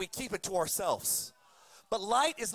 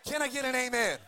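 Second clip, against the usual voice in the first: calling out. Real, not acted. Preacher calls out to congregation.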